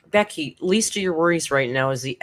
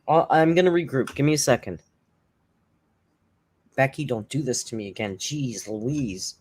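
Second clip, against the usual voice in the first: normal talking voice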